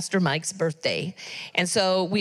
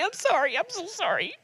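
real tears, voice rising high and breaking; crying; 9 semitones higher than usual. Real, not acted. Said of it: "I'm sorry, I'm crying".

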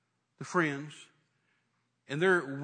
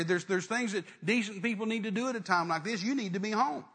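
worked-up, indignant relatives' reproach; nagging, reproachful messenger; acted scolding; 2 semitones higher, 4 dB louder than usual. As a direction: pile up the complaints, pitch lifted, pressing hard.

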